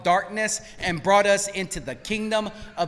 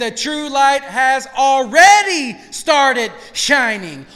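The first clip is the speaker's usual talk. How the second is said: slow, louder, weighty proclamation; preached scripture; 8 dB louder, slower than usual. Acted. Preacher quoting Yeshua to congregation, slow proclamation. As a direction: proclaim each word to the hall.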